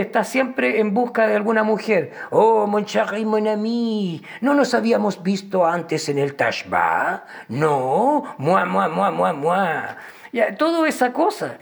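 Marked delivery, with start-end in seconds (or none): 2.3-9.6 mock French seducer accent; lightly hammed French accent. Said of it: "un estereotipo contra el francés".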